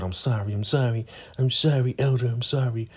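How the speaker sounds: lightly voiced, grovel through the apologies; mock pleading.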